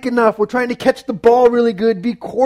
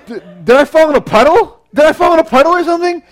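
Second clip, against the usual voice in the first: high, loud puzzled questioning; mock-baffled, pitched up and louder; acted bewilderment; 7 semitones higher, 6 dB louder, faster than usual. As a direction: play rising confusion, getting higher. Acting out his confused realization.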